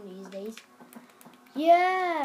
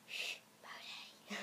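hushed, drawn-out whisper; secretive whisper; 15 dB quieter, slower than usual. Real, not acted. Whispers a friend's name as secret.

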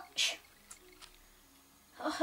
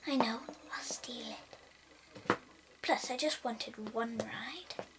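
soft unvoiced scheming; plotting to steal it quietly; hushed mischievous plan; sneaky whisper; 4 semitones lower, 6 dB quieter than usual.

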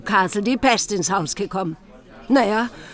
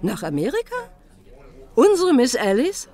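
astonished; 5 semitones higher than usual. Acted. Landlady astonished at news.

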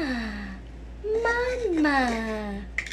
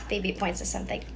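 ordinary delivery

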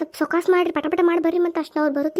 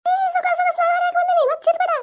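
high startled shout; sudden shout; 11 semitones higher than usual. Acted. Woman caught at the plant, shouting high and startled. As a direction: yell out to stop the neighbour.

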